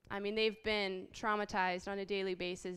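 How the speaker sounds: normal talking voice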